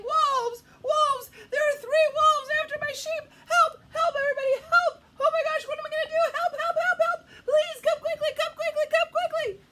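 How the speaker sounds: very high, loud frantic cries; panicked yelling. A narrator's reading. Shepherd boy begging the town for help, frantic yelling. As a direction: scream for help, pleas tumbling out. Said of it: "this time yelling".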